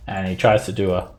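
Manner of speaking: usual speaking voice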